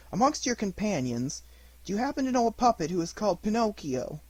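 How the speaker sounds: a curious pigeon asking from the sky; gentle big pigeon. Acted. Pigeon asks about Pinocchio.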